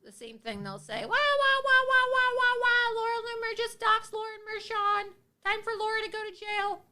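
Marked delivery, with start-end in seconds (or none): 1.0-3.0 put-on high, loud sing-song baby wail; mock crying wah. Mocking her critics as crybabies, with a high, loud sing-song wah. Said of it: "all these people do is whine". 3.0-6.8 high, nasal mocking whine; whiny critic voice. Mimicking critics who complain about doxing, in a high mocking whine. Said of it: "all these people do is whine".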